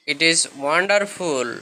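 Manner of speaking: slow, stretched English phrase; slow model sentence; slow teaching model. Shown as a demonstration. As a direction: draw out wonderful for learners. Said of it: "এটা চমৎকার".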